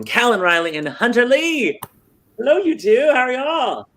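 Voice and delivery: high, bright-eyed hello; excited greeting. Real, not acted. Host greeting his two lead actors, thrilled and high.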